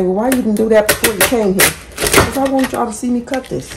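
usual speaking voice

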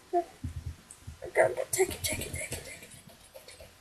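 whispered, hurried go take it; urgent whisper. Real, not acted. Player whispering urgently to grab the item. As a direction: hiss it out quickly under the breath. Urging a teammate during a chase.

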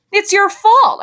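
angry, loud retort; spitting back. A narrator's reading. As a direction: spit it back at her. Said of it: "I spit back at her".

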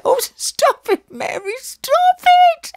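very high, drawn-out shriek; helpless squealing plea while being tickled. A narrator's reading. Alice, tickled by her sister, pleads in shrieks. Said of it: "Alice pleaded, shrieking and giggling helplessly".

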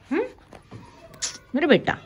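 usual speaking voice

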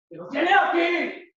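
high, loud, drawn-out yell; slow, heated holler; angry shout. Acted. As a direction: shout the repeat in exasperation. Acted quarrel, shouted repeat.

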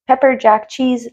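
normal talking voice

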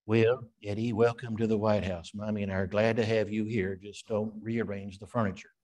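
a hint of Reagan's folksy voice; lightly voiced Ronald Reagan; Reagan impression. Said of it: "that's a really good Reagan".

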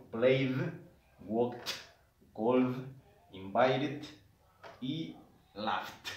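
"Played, walked, called, invited y laughed" with each final d or t clearly released; emphasised endings. Shown as a demonstration. Teacher telling students to stress endings, clearly released -ed sounds. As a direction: make every past ending audible, word by word. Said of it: "tratar de que se escuche mucho".

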